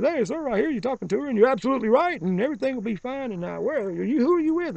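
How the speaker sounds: high, overly polite official voice, hold it well up; mock official voice. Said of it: "environmental guys comes over and says".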